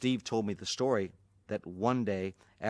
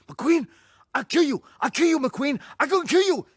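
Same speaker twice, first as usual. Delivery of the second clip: much higher and louder, raging; furious threat; 9 dB louder than usual. Retold or imitated. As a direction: scream the threat, beside himself. Bruce furious at Steve.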